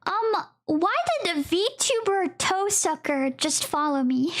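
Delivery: ordinary talk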